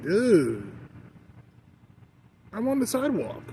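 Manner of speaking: high, loud, incredulous "dude" at a stranger; exasperated retort. Retold or imitated. Talking back to people who make a situation, exasperated and high-pitched. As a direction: throw it back at them, disbelieving.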